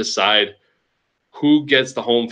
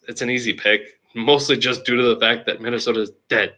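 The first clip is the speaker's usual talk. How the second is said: usual speaking voice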